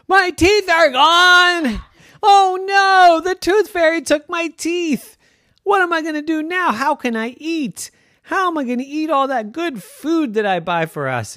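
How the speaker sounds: high, loud, panicky wail; daddy panicking that the tooth fairy took his teeth; panicked wail. Acted. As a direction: despair over lost teeth.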